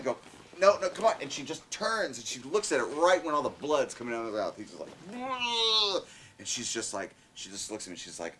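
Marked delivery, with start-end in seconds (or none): 0.6-1.1 higher, louder urgent coaxing. Retold or imitated. Father urging daughter to leave. 5.1-6.0 mock dying groan, high and strained. Shark victim's death sound.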